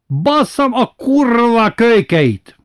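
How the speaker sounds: high, slow, furious curse shouted from afar; furious roar. A narrator's reading. Angry man roaring at the kids breaking windows, furious high shout. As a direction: bellow it with rage at the kids. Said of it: "kintről ordítás harsant".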